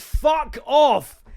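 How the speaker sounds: loud, high outburst of dismissal; exasperated shout. Acted. Comedian rejecting a recruitment consultant's text, exasperated shout. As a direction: explode at the recruiter.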